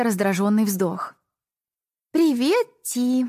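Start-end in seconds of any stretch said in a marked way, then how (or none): 2.1-3.3 put-on bright young girl greeting; lightly voiced young girl; girl's voice. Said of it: "поздоровалась Сабрина".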